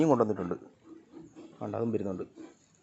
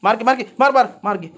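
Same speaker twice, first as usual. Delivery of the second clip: higher, loud shoo-away calls; shooing loudly; 5 semitones higher, 10 dB louder than usual. Real, not acted. Owner shooing crowding cats back, loud.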